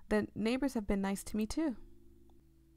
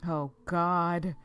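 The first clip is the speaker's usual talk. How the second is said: startled outburst; 5 semitones higher, 6 dB louder than usual. Real, not acted. Jump-scare reaction.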